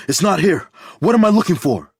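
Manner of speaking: high, loud panicked cry; frantic, near breaking; desperate searching shout. A narrator's reading. Dave panicking searching the bag.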